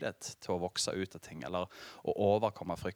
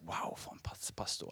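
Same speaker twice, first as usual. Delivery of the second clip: softer, breathless whisper of awe; mock-impressed whisper; whispered admiration; 5 semitones lower, 9 dB quieter than usual. Churchgoer leaving service praising the pastor, whispered.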